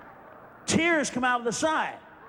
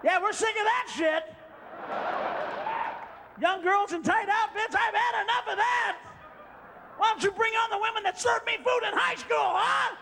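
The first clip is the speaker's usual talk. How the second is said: sarcastic yell; 8 semitones higher than usual. Retold or imitated. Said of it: "I go".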